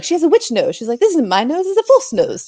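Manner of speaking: high mock accused woman; lightly hammed-up Python peasant; squeaky witch-trial voice. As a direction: pitch it up like a silly villager. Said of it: "they're like".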